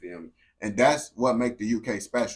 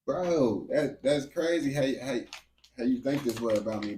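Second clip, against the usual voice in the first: ordinary delivery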